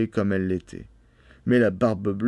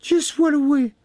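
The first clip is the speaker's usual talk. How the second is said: very high cry of relief; exclaiming; 4 dB louder, slower than usual. A narrator's reading. Wife hearing of rescuers, high relieved cry. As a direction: cry out thankfully in falsetto. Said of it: "s'écria-t-elle un moment après".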